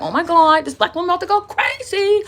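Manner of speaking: high-pitched mock scandalized panic; pearl-clutching onlookers. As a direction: imitate aghast bystanders, way up high. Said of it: "was clenching their pearls".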